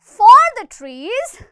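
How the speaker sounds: slow, loud, cross demo line; a touch of anger. Shown as a demonstration. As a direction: say it with a little anger. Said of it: "पर थोड़ा सा गुस्सा जरूर रहेगा". (थोड़े गुस्से में)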